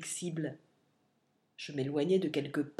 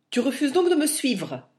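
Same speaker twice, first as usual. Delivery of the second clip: higher, louder angry outcry; vive colère; 7 semitones higher, 7 dB louder than usual. A narrator's reading. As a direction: shout it in sharp anger. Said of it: "s'écria-t-il avec une vive colère". (colère vive, voix haute et forte)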